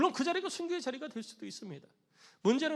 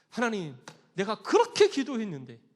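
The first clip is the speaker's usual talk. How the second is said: loud, raised-pitch protest; acted aggrieved outburst; pouring out resentment; 4 semitones higher, 7 dB louder than usual. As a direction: complain bitterly to God, demanding why. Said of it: "하나님을 향해서 막 원망을 퍼붓는 거예요".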